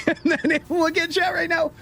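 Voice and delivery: slow, giggling, high; laughing. Real, not acted. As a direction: laugh through the words. Laughing at chat spam.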